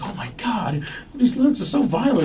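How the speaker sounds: high breathy whisper of a scared principal. Retold or imitated. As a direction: gasp it out, shocked and frightened. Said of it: "she's like".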